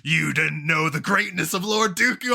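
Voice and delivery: grand mock villain proclamation; Christopher Lloyd villain. Villain worshiping his lord rises to speak, hammed-up reverent villain. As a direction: proclaim it like a devoted zealot. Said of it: "villain ends up being our boy Christopher Lloyd".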